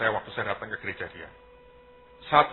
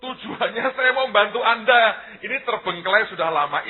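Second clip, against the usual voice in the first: high, raised-voice protest of good intent; insistent reassurance; 10 semitones higher, 4 dB louder than usual. Retold or imitated. Answering suspicious pastors about his offer, high insistent reassurance. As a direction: insist loudly that you only want to help.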